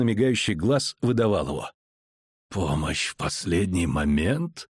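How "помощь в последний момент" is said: low, slow, grating disbelief; slow rasping low voice. A narrator's reading. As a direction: grate it out slowly and low. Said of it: "проскрежетал он".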